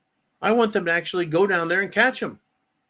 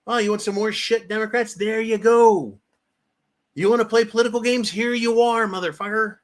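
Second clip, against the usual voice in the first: higher, sneering mock voice of a bully; mocking taunt; 6 semitones higher than usual. Host mocks Democrats taunting Republicans, higher sneering voice. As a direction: taunt them like a gloating bully.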